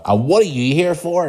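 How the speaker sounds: high, snappy mock challenge; imitating the small woman rounding on him; a hint of a stern desk worker; mock snappy demand. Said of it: "she literally turned around and she went".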